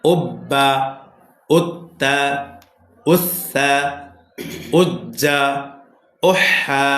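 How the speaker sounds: measured word-by-word reading, doubled consonants held; reading drill. Shown as a demonstration. Teacher showing students how the joined syllables read, measured drill reading. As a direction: read each word alone, evenly, with pauses between. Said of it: "এবার reading লক্ষ্য করেন".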